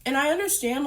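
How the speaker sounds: normal talking voice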